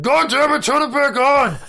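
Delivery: very high, loud Sloth-like bellow; Brett the janitor. Retold or imitated. Janitor plunged into darkness yet again, mock-furious bellowing. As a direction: holler as an exasperated, hammed-up impression. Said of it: "He's like".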